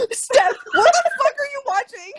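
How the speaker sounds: high laughing yell; laughing shriek. Real, not acted.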